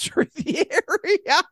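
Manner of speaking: high, laughter breaking into the words; helpless giggle through the line; laughing while reading. Real, not acted. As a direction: crack up as you read it, dragging out the words.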